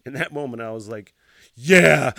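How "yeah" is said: loud, irritated acknowledgment; retold mad reply. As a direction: throw it out forcefully, like a dick. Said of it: "I was so mad in that moment".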